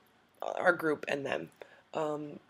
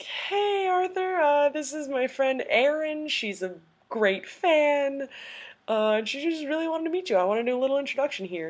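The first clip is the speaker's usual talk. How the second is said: imitating a friendly host, pitched up; upbeat introduction; 9 semitones higher, 4 dB louder than usual. Voicing Michael introducing her.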